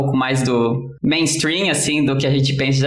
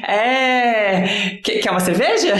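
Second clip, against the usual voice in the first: drawn-out, higher hesitant filler, then a quick offer; awkward dodge; 5 semitones higher, slower than usual. Retold or imitated. Partygoer lost on Star Trek references, awkward stalling. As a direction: stall on a long pitched-up word, then change the subject. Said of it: "eu ficava muito".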